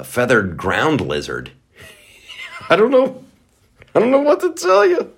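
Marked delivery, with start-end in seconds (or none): 2.1-5.1 high, laughing through the words; real laughter, higher. Real, not acted. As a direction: giggle it out in disbelief.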